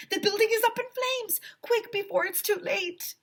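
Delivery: high, frantic call; rushing everyone out; urgent shout. A narrator's reading. Mister Cooper urging kids out.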